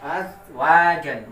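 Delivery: "azwajan" slow, each syllable stretched; drawn-out reading. Shown as a demonstration. Teacher reads the Iqra word slowly to pupils. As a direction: spell it out slowly for the class. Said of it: "Di sini ada tulisan".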